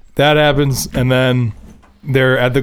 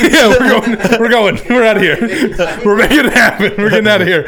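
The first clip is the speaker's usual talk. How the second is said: laughing; 8 semitones higher, 3 dB louder than usual. Real, not acted. Laughing, mock leaving.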